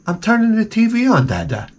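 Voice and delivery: high-pitched, lightly voiced child; imitated young son. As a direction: answer Dad like a little kid. Retold son answering his dad.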